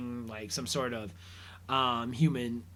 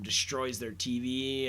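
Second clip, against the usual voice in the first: usual speaking voice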